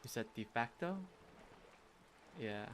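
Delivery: normal talking voice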